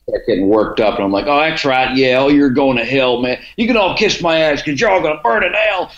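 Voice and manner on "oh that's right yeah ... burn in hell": much higher, hammed-up preacher condemning the crowd; goading the audience as a fake preacher, high and theatrical; mock hellfire preacher. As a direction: play the fire-and-brimstone preacher to rile the audience.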